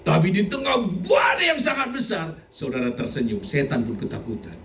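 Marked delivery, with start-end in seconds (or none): 0.0-2.3 higher, rising preacher's call; preaching shout. Acted. Pastor calling out over the congregation. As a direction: build it up like a cry. Preaching about a great storm.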